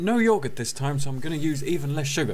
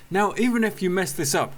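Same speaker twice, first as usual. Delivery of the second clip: ordinary talk, nothing marked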